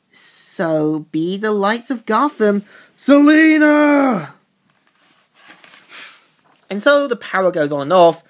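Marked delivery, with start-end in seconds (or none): 0.1-4.3 slow, rising into a high shout on her name; building to a shout. Acted. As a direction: start slow and menacing, then explode on Selina. Selina's climactic final strike.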